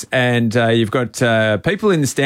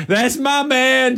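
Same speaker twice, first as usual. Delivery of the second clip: higher, slow, drawn-out mock jeer; imitating a heckler; 11 semitones higher, slower than usual. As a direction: stretch it to put him off. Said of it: "So the guy goes".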